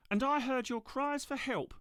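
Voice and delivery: high, slow small voice; a sweet little trolley. Acted.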